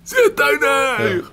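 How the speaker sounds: very high, drawn-out mock yell; stadium yell imitation. Fans at Malmö stadium yelling at him, exaggerated long yell. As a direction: stretch it into a shrieking heckle. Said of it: "som de skrek på Malmö stadion".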